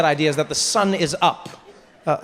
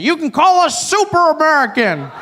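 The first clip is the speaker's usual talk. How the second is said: high, hollered Southern gentleman's suggestion; retold shout; 7 semitones higher, 3 dB louder than usual. Southern gentleman calls out a name to the comedian, retold shout. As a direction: imitate a man calling out across a crowd. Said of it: "a gentleman shouts it out".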